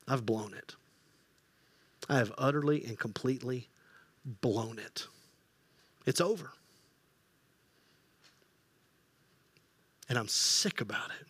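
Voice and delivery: low, soft heartbroken admission; crushed despair. Acted. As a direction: let the guilt sink you. Peter realizes his betrayal.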